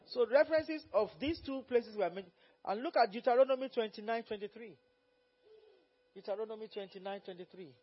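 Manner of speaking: loud, fervent preaching, quoting God. Acted. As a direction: preach it out to the congregation. Preacher at full force.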